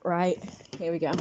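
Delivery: usual speaking voice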